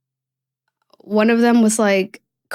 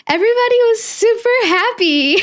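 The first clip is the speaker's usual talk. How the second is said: high, bursting with happy excitement, then a laugh; overjoyed. Real, not acted. Telling viewers everyone was happy about her pregnancy, overjoyed and giggling. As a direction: let the joy spill over into a giggle. Joyful reveal of friends' reactions.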